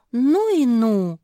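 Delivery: high, loud, drawn-out reproach; disapproving head-shake. A narrator's reading. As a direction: stretch it out in dismay. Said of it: "покачала головой Куки". (протяжно, с укоризной)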